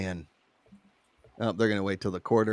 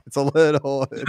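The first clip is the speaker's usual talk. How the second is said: ordinary talk, nothing marked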